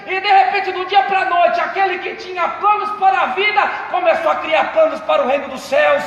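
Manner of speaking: loud, ringing pulpit testimony with music; preaching at full force. Acted. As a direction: preach it out to the whole church. (pregação inflamada em voz alta)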